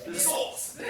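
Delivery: high, playful imitation of an Italian accent; jokey put-on Italian accent; mock Italian accent. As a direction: mimic someone's Italian accent, laughing. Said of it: "He says with an Italian accent".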